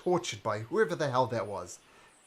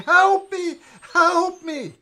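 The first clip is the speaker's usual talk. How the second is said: high, very loud desperate cries; screaming for help; 15 dB louder, slower than usual. Acted. Game master as the burning man in the vision, screaming for help. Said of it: "standing there screaming".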